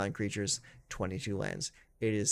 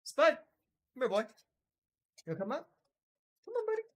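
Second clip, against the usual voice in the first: much higher sing-song pet-calling voice; calling the dog; faster than usual. Real, not acted. Owner coaxing his dachshund Spud onto the couch, high doting call.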